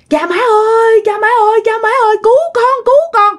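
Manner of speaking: very high-pitched acted wail; panicked cry for help. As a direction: cry out for mum and dad to save you. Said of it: "ba la quá trời la luôn". (kêu cứu hoảng hốt)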